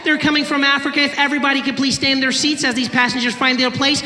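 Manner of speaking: high, loud imitation of a cabin announcer; air hostess announcement. Air hostess addressing passengers over the microphone, high announcer voice.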